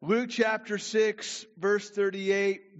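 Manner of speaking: preacher announcing the text; loud announcement. Real, not acted. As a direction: call out the verse from the pulpit.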